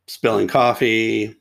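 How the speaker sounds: ordinary talk, nothing marked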